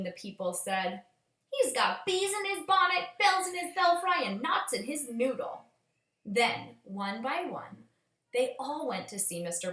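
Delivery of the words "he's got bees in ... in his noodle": much higher, sing-song mockery; high gossiping neighbors. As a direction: tease it like busybodies. Neighbors mock the dreamers.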